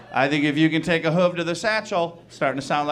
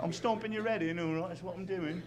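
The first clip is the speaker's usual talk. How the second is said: soft, high mock British threat; adorably quiet British trash talk; 3 semitones higher, 12 dB quieter than usual. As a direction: mumble menace in cartoon British. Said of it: "They talk trash in British".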